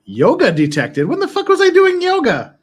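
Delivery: loud, soaring high indignant question; outraged disbelief. Real, not acted. Outraged at fitness app.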